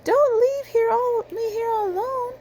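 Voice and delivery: high plaintive plea; pleading sheep. A narrator's reading. Reading aloud, Sheep pleading not to be left alone. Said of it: "cried Sheep".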